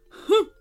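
high, loud scornful snort; snorted humph. Acted. Crocodile unimpressed by the girl, a loud scornful snort. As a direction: snort out your disdain. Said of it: "snorted the crocodile".

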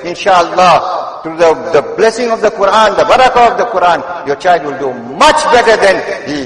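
loud, high-pitched sermon delivery; impassioned preaching. Real, not acted. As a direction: preach it at full force, pitched well up. Preaches at full force.

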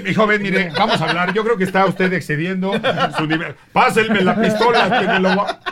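mock policeman. Fake policeman calming the armed general, mock authoritative. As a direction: try to calm him with authority. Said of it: "Y yo así".

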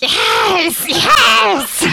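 high-pitched, loud creature shriek; Gollum shriek. Acted. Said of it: "shrieked Gollum".

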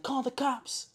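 high-pitched mock cry for help; high, held-back retold yell; imitated yell. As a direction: mimic a woman yelling, without full volume. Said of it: "she's yelling".

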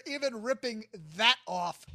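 ordinary delivery

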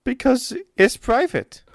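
high, dramatic voice with broken English; fretful noblewoman. Acted. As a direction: wring your hands over the poisoning. GM voices Olga.

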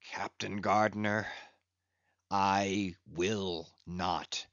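slow, drawn-out, each word moulded; stretching words. Acted. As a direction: stretch every word out slowly. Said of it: "in a voice that prolongingly moulded every word".

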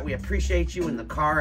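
usual speaking voice

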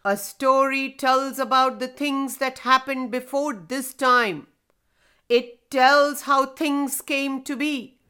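deep rumbling voice of an ancient stone; grinding rumbling rock voice. Acted. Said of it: "He heard the deep voice again".

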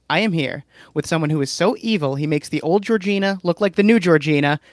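ordinary talk, nothing marked

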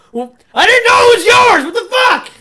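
a dad bellowing his defence at his son; dad shouting. Retold or imitated. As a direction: yell it back like an outraged dad. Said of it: "it turned into".

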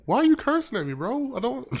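very high, softened whine of protest; mock hurt protest. Learner playing the cursed-at bro for laughs, mock hurt. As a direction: pretend to be wounded by cursing.

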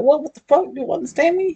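high, shocked disbelief; shocked outburst. Retold or imitated. Woman realizing he understood her all along, shocked and high. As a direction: blurt it out, startled and high.

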